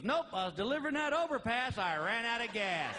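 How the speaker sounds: deadpan trucker comeback. Retold or imitated. Trucker to patrolman, dry sarcastic comeback. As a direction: fire back without missing a beat.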